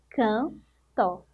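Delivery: "Canto" as two parts, can, pause, to; split into syllables. Shown as a demonstration. Teacher counting two syllables for pupils, "Canto" split apart. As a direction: break "Canto" after "Can" with a clear gap. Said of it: "Duas sílabas".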